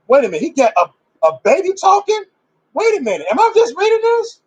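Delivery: high-pitched astonished double take; incredulous. Acted. Preacher stunned by a baby talking in scripture, incredulous. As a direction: squeal it in mock disbelief, voice shooting up. Acts out disbelief at scripture reading.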